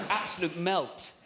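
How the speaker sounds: slow, drawn-out posh insult. Acted. Child insulting Dad in a mimicked posh accent. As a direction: imitate a posh child using slang. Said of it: "in a posh voice".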